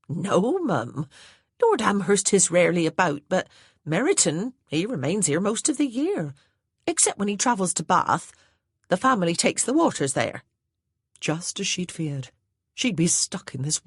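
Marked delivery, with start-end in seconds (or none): 0.1-10.4 higher, louder servant voice with a country lilt; a plain-spoken old housemaid reporting orders; Hattie the maid's voice. A narrator's reading. Maid Hattie to her new mistress, higher and louder.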